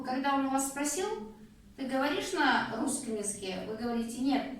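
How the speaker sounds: normal talking voice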